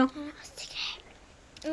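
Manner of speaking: quiet whispered aside; whispering. Real, not acted. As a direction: whisper it under your breath. Hushed aside while eating.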